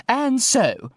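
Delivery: high, grand posh lady; plummy ceremonial flourish. Acted. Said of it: "concluded Judy".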